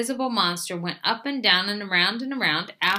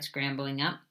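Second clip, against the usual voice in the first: ordinary delivery, nothing marked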